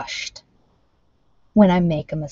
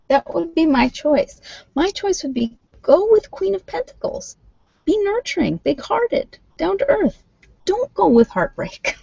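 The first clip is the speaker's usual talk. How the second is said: pitched way up and keen; high eager urging; 7 semitones higher than usual. Real, not acted. Tarot reader urging a viewer toward the good card, excited and high.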